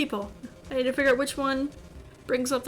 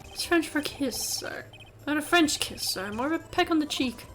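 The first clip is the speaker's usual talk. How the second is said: prim English bellboy explaining; clarify primly in a mock English accent; horrible English accent; 3 semitones higher than usual. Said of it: "saying it in this horrible English accent".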